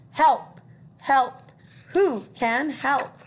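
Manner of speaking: loud cries for help; duck calling for help. Acted. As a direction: shout it out for help.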